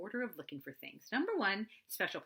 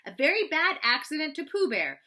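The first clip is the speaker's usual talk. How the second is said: much louder and higher, testing his voice; loudly; 10 semitones higher, 12 dB louder than usual. Acted. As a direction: announce it loudly to be sure. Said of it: "he said loudly".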